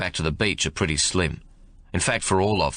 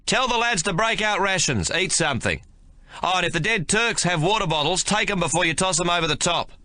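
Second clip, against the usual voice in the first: calling out; 10 semitones higher than usual. A narrator's reading. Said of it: "Ben calls out after Hornbill".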